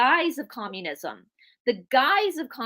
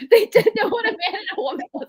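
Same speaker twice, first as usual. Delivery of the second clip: laughing through the words, higher and louder; 12 semitones higher, 6 dB louder, faster than usual. Real, not acted. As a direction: giggle out the concession. Laughing while conceding a point.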